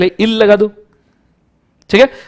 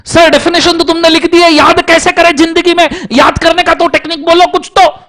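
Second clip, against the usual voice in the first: high pleading student voice, much higher; whiny student plea; 9 semitones higher than usual. Retold or imitated. Teacher mimicking students begging for a memory trick, high pleading.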